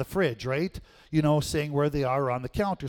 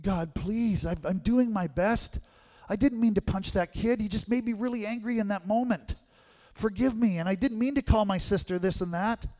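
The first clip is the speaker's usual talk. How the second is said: higher, mildly pleading prayer; 6 semitones higher than usual. Retold or imitated. Boy on his knees in the kitchen begs God, mildly pleading. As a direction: beg God for forgiveness, pitched higher. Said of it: "pleading with the Lord".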